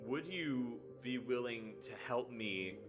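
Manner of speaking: ordinary talk, nothing marked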